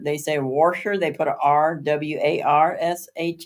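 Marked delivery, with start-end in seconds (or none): 0.4-1.0 warsher with an R before the sh, wor-sher; dialect warsher. Shown as a demonstration. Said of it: "And when they say washer".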